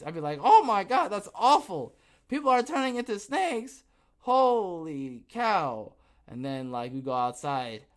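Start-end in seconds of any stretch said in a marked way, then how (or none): 0.4-5.9 high, loud hammed-up alarm; hammed-up gasping dismay; mock horror. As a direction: overplay the shock, then stretch "holy cow" slowly. Mock-alarmed reaction to snakes.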